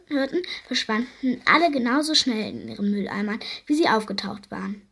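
plain reading voice

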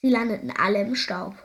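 ordinary delivery

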